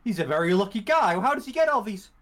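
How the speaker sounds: high, wide-eyed wonder; naive amazement. Retold or imitated. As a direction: marvel like a gullible kid. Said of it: "Yeah, like".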